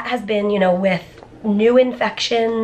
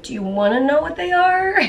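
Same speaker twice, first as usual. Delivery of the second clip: lightly imitated, higher-pitched careful asking; imitated question; 8 semitones higher than usual. Retelling the technician offering the test numbers, imitated higher question. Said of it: "she was like".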